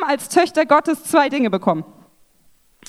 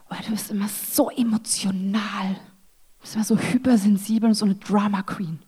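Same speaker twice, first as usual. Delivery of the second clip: whispered, soft mimicry of the critics; mocking whisper; 3 semitones lower, 5 dB quieter than usual. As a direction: hiss the put-down back at them under your breath. Voicing others' criticism of her.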